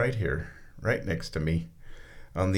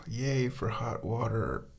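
usual speaking voice

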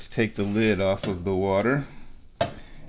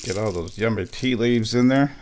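ordinary talk, nothing marked

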